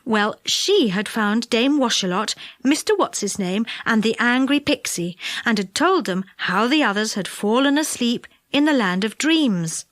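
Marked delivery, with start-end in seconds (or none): none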